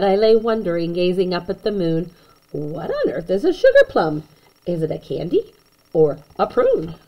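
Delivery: ordinary delivery, nothing marked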